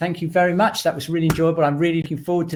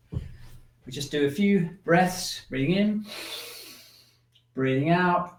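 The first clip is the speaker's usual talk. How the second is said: slow, soft breathing cues; guided breathing; 3 semitones higher, 5 dB quieter, slower than usual. Shown as a demonstration. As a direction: lead the breath gently, drawn out.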